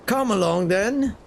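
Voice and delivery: put-on British accent, lifting high at the end; old British accent. Sailor beckoning the new deckhand, old British accent. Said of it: "now I sound like an old British folk".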